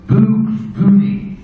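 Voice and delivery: a puzzled wrestler hears the Australian major say it again; slow Aussie repeat. Retold or imitated. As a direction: slowly repeat it, Aussie style.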